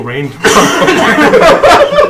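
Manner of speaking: slow bet said through laughter; cackling smug wager, slowly; laughing. Acted. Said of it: "I start making bets with people, like".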